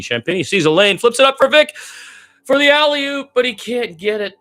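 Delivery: high, loud burst as the lob goes up; excited call. Real, not acted. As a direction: call the alley-oop at full cry. Alley-oop attempt called live.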